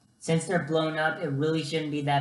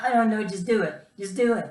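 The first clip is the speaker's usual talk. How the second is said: pumping myself up; 5 semitones higher, faster than usual. Retold or imitated. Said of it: "I was just pumping myself up".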